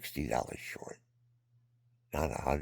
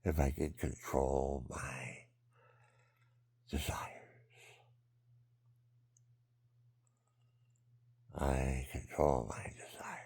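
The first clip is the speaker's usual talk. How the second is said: whisper; 2 semitones lower, 6 dB quieter, slower than usual. Real, not acted. Whispering self-reflection.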